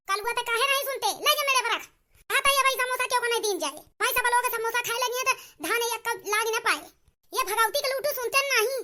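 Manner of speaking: loud, angry telling-off; loud scolding. Acted. Elder scolding the boy over samosas, loud and angry. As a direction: scold the boy for not listening.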